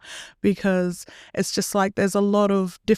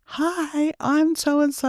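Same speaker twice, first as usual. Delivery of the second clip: high-pitched imitation of a shy introvert; 9 semitones higher, much slower than usual. Extrovert pastor greeting someone shy, putting on an introverted voice. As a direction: put on a much higher, introverted voice. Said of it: "put my introverted voice on".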